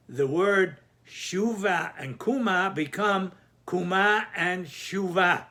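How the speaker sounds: Shuva and Kuma with the stress at the end, slow; stress on last syllable. Shown as a demonstration. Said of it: "It becomes Melera".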